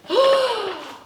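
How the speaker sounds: high surprised gasp; storyteller's gasp of amazement. A narrator's reading. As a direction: gasp in wonder.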